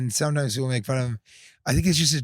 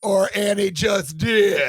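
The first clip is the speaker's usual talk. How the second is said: teasing shout across the table; 6 semitones higher, slower than usual. Real, not acted. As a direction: belt the tag line out. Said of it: "shouted punchline".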